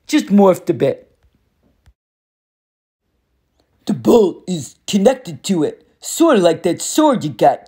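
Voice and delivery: lightly voiced cocky war god, taunting; a hint of a swaggering bully, amused; smug war god. Ares condescends to Percy.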